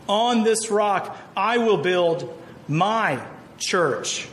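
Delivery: each word drawn out and weighted; slow, deliberate recitation of a verse; slow solemn quote. Shown as a demonstration. Quoting the verse slowly.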